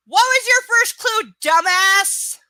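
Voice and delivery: loud, high, exasperated yell at the character; angry shout. Real, not acted. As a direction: shout it at her like she's an idiot. Yelling at the book's heroine.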